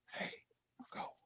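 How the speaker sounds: hushed, urgent signal; barely audible whispered cue. Acted. Kids sneaking through the base, whispering.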